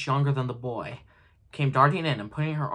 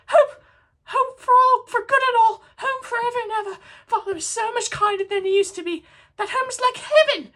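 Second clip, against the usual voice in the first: put-on much higher, louder, laughing child voice; little girl; 8 dB louder than usual. A narrator's reading. Little Fan fetching her brother home, gleeful child voice. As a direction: bubble over like a delighted little sister. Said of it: "brimful of glee".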